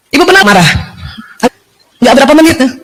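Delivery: ordinary delivery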